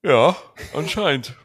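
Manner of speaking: yawning through the words. Real, not acted. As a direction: say it mid-yawn. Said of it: "Hör auf zu gähnen".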